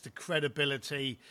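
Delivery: ordinary talk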